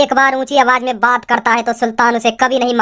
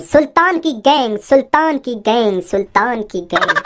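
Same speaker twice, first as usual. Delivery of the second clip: slow, lower and dying away like a movie echo; fading echo; 5 semitones lower, slower than usual. Acted. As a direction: let the gang name echo out into silence. Repeated name fades away. (फ़िल्मी गूँज की तरह धीमे धीमे मद्धम होती आवाज़)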